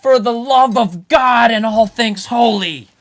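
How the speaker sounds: loud, slow hammed-up despair; exasperated outburst. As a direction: beg heaven in mock despair.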